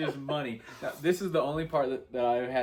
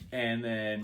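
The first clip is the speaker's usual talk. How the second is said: ordinary delivery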